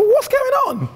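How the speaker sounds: high, squeaky bewildered question; a hammed-up baffled squeak. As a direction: pipe up in total confusion. Baffled at teammates' odd behaviour.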